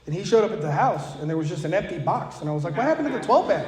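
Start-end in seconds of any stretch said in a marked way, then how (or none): 2.8-3.7 much higher, louder what happened; hammed-up disbelief; mock surprise. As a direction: finds the empty beer box, mock surprise. Finds empty box.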